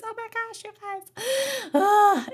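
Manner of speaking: choked-up gasp, voice breaking up high; tearing up. Real, not acted. Telling viewers about the student's thanks, really tearing up. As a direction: let the emotion catch her voice. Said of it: "I'm gonna get emotional".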